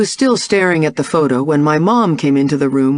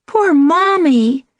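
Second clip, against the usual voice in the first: put-on little girl voice; 11 semitones higher, slower than usual. A narrator's reading. Young daughter voiced by narrator.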